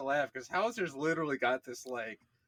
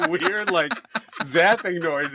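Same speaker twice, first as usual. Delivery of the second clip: normal talking voice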